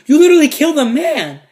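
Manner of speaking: high, loud incredulous outburst; acted-out shocked exclamation; shocked disbelief. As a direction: confront him, appalled. Narrator confronting cousin in disbelief.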